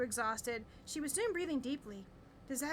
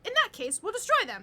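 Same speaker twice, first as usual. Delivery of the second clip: high, loud, determined vow; bold declaration; 6 semitones higher, 8 dB louder than usual. A narrator's reading. Claire vows to the family to fight the undead, loud and high. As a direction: declare it like a heroine. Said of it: "Claire declared".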